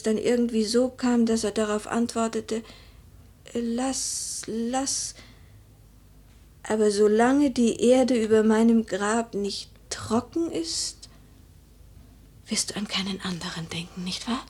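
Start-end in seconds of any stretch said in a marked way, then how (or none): 3.5-14.4 a fading man clinging to his wife; dying man's whisper. Acted. Widow quoting her dying husband, hushed whisper.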